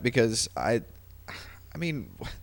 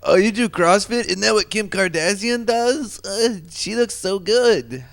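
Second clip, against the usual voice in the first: lightly put-on Eastern European accent, higher; 7 semitones higher, slower than usual. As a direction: quote them in a hint of Eastern European accent. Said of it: "Russian Polish guy accent".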